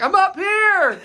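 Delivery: high, loud retold holler; yelling. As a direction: call down from high up to get noticed. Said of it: "I have to yell".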